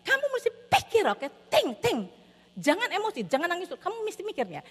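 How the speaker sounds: high-pitched, pressing parental urging; insistent scolding. Retold or imitated. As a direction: press each point on an upset child. Parent urges child to think.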